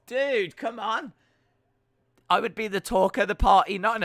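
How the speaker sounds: playful loud protest to a chatter; indignant protest. Real, not acted. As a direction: push back loud and high, mock offended.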